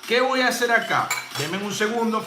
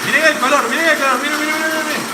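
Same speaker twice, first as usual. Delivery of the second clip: excited showing off; 6 semitones higher, 6 dB louder, faster than usual. Real, not acted. Presenting the cocktail's color excitedly.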